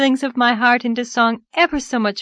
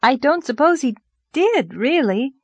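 higher-pitched, hedging defence; trying to be fair; 6 semitones higher, 3 dB louder than usual. A narrator's reading. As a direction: reason it out fairly. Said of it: "trying to be just".

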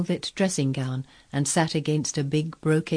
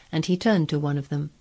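plain reading voice

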